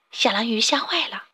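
plain reading voice

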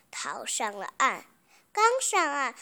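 plain reading voice

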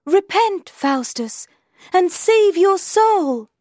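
very high, loud exhortation; high angelic call, stretched at the end; good angel. A narrator's reading. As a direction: urge him in a high, ringing angel voice. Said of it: "the good angel said".

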